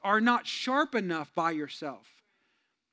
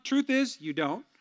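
usual speaking voice

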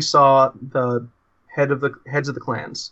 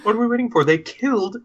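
higher, indignant outburst about the killing; outraged protest; 6 semitones higher than usual. Acted. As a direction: demand action, pitch rising in outrage. Player outraged at call for patience.

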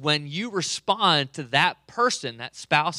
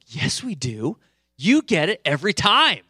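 frustrated wife; 3 semitones higher, 5 dB louder than usual. Retold or imitated. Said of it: "she starts to get frustrated".

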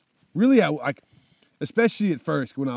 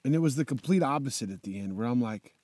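ordinary talk, nothing marked

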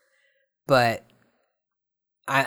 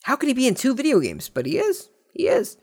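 much higher, fast, climbing to a squeak; mock outrage rising to squeak; 11 semitones higher, 3 dB louder than usual. Vlogger hams up disbelief about Vin Diesel's second game. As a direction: build the fake shock until it cracks high.

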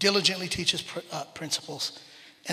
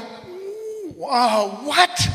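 high drawn-out ooh, then a louder what; retold disbelief; 9 semitones higher, 3 dB louder, much slower than usual. Parent reacting to a son who argues back, acted disbelief. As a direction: act out a taken-aback parent. Parent retelling shock at son's pushback.

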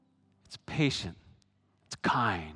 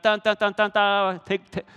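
high loud dun dun dun, like a fanfare; sung fanfare; 9 semitones higher, 7 dB louder than usual. Retold or imitated. Preacher mimicking Pastor Bob's "so that" fanfare. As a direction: belt out a mock drumroll. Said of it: "Pastor Bob always went".

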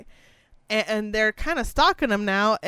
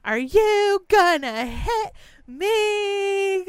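put-on high, drawn-out drunk taunt; sing-song dare, much higher and slow; mock drunk girl daring a hit; 11 semitones higher, 4 dB louder, slower than usual. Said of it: "she's like".

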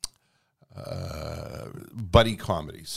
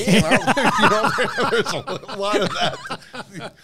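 laughing; 11 semitones higher, 5 dB louder, slower than usual. Real, not acted. Laughing at own impression.

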